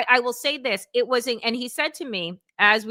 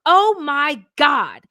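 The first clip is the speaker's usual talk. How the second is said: surprised; 5 semitones higher, 6 dB louder, slower than usual. Retold or imitated. Driver learns he is an analyst.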